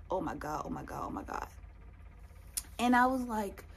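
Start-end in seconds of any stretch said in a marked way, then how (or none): 0.1-1.5 low, soft repeated murmur; retold quiet dread. Recalling waiting alone all day, quiet dread. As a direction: murmur it to yourself, low and rapid-fire.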